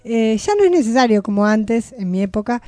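usual speaking voice

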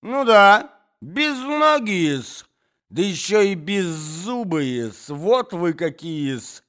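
высокий громкий визг негодования; обрушься на князя с визгом; визгливая брань. Acted. Дама бранит князя. (обиженная дворянка визгливо бранит князя)

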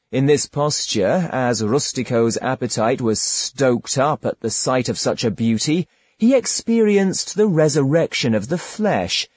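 plain reading voice

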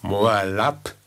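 drohend laut gerufen; lauter Ruf. Acted. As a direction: ruf den Namen scharf. Okba ruft vor dem Stoß. (lauter drohender Ruf)

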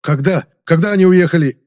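громкий взвинченный крик, выше обычного; требуй ответа, почти срываясь на рёв. A narrator's reading. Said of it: "взревел я". (взревев, громко и требовательно)

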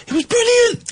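gushing. Real, not acted. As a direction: squeal it with delight. Gushing about concert.

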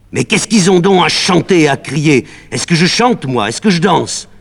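high, loud outcry at the singing; crying out. Acted. Médée crying out at the villagers' singing, loud and high. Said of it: "elle crie".

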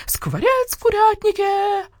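high, long crowing alarm; piercing scream. A narrator's reading. Rooster raises the alarm in the henhouse, piercing scream. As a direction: crow it out piercingly. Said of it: "заорал Петюня ещё пронзительнее".

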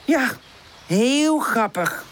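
slow, drawn-out sarcastic reply; weary, sarcastic storybook delivery; unamused sigh. A narrator's reading. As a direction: drag the words out, not amused. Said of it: "Pip zucht".

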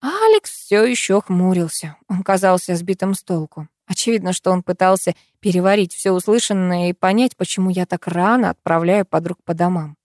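plain reading voice

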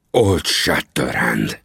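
a lightly voiced furious tremble; trembling voice. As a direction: repeat the name, shaking with anger. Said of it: "ismételte Tangu a reszkető hangon".

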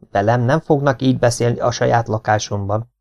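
ordinary delivery

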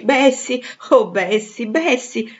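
loud, high delighted cries; overjoyed exclamation. A narrator's reading. Jane kisses and embraces her old Bessie, crying out joyfully. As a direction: cry out the name with overflowing joy.

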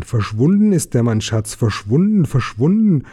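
ordinary delivery

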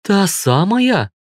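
slow, high-pitched astonished question; wide-eyed surprise. A narrator's reading. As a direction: draw it out high in amazement. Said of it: "округлила глаза Юля".

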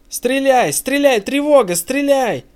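hollow, booming high yell, as if from inside a barrel. A narrator's reading. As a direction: yell an alarm from inside a metal tank. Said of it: "Гулко, как из бочки, начинает кто-то вопить".

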